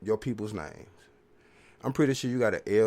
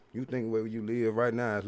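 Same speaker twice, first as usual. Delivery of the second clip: ordinary delivery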